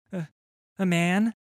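put-on high, stammering scared boy; faltering boy. Frightened urchin telling the captain, faltering. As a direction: stumble over the first words. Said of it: "his words faltering".

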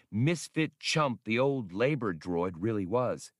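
ordinary delivery

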